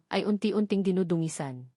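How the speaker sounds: ordinary delivery, nothing marked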